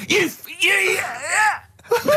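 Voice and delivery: high squeal of laughter, words breaking up; can barely get the words out for laughing; helpless high-pitched laughing protest; laughing shriek. Real, not acted. Cracking up at Karl's story.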